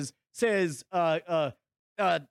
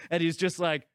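ordinary talk

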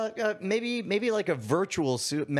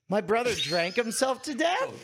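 higher, turned into a question on "death"; mock-innocent uptalk ending on "death"; question intonation; 6 semitones higher than usual. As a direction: lift "death" as if only asking. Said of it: "say it like a question".

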